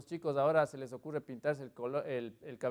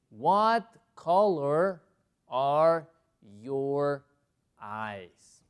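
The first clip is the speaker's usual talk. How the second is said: very slow, separated words; word-by-word model; 8 dB louder, much slower than usual. Shown as a demonstration. Teacher introducing a new question, slow and deliberate. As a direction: read it out word by word.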